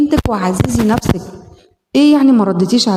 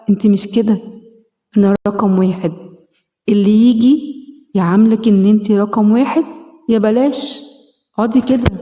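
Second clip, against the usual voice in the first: normal talking voice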